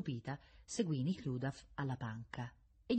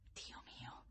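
hushed, voiceless whisper; very soft whisper to oneself; whispered prayer; 21 dB quieter than usual. A narrator's reading. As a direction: plead under your breath. Silent prayer to himself.

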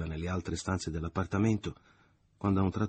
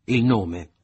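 louder, clipped demand for the name; curt loud demand; 4 semitones higher, 10 dB louder than usual. A narrator's reading. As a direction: bark out the demand. (domanda secca e forte)

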